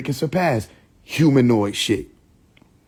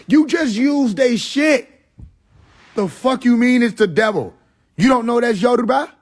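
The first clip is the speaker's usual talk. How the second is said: indignant retort; 10 semitones higher than usual. Acted. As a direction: throw it back at them in disbelief, pitched high.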